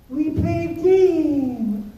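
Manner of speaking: put-on high, very slow toddler voice; little kid voice. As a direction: drag it out like a small child.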